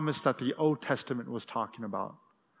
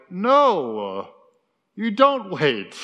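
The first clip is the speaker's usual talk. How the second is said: louder, slow, firm no; firm MC rebuke; 4 semitones higher, 6 dB louder, much slower than usual. Retold or imitated. MC correcting the crowd, loud and slow. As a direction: slam the brakes on them. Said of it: "the M C was like".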